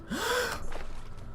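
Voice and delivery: high gasping breath; gasp for air. Pretend. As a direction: suck in air like surfacing. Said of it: "Holding my breath".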